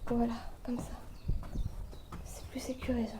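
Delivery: soft, tense whisper. Real, not acted. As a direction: keep it hushed while balancing. Whispering on a beam over a drop.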